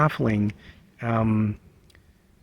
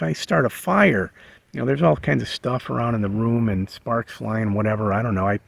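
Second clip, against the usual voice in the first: normal talking voice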